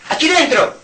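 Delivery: high, loud mock order; a touch of commanding cleric. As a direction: lightly imitate the church ordering them in. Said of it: "Y han dicho a las mujeres".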